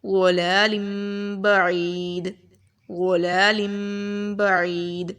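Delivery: chanted tajweed recitation with a nasal M; Quran recitation. Shown as a demonstration. Teacher recites the example for the students. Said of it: "تنوین کی example میں دیکھیں".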